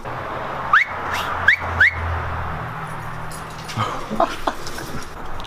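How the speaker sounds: long high, soft giggle; wheezy high laughter trailing on; giggling fit. Real, not acted. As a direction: laugh helplessly, mostly on breath. Laughing at the dog.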